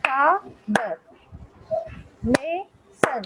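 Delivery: father as fa, pause, ther and mason as ma, pause, son; clapped-out syllables in father, mason; broken into syllables. Shown as a demonstration. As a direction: split father and mason into two separate beats each. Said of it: "ऐसे एक बार में ही नहीं बोल पाऊंगी".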